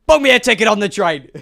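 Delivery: loud, confident order; triumphant shout. Acted. Traveler sure he solved it, triumphant shout. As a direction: belt it out in triumph.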